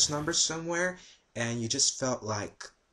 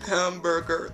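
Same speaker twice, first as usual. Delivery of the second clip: Hamburger pitched up, said with a strained odd voice; higher hamburger; 5 semitones higher than usual. Pretend. Agent tries again for the angry caller, pitched up. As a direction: try Hamburger again in a raised put-on voice. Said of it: "I said say hamburger".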